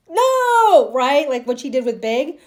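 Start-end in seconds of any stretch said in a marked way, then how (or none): 0.1-0.9 outraged no. Retold or imitated. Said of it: "Charlotte would have gotten up like".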